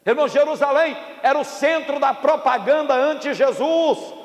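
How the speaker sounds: high, projected pulpit delivery; impassioned preaching. Real, not acted. Preacher warns congregation about failure, impassioned preaching. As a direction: preach it out with full conviction.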